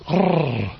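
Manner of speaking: rrr as a sustained tongue trill; long trilled rrr, tongue oscillating; rolled R. Shown as a demonstration. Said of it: "what are called trills or rolls".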